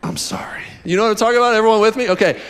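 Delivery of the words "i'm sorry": deep, soft, breathed-out plea. Shown as a demonstration. Preacher shows the spoken bit at song's end, deep soft croon. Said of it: "at the end, the guy's like".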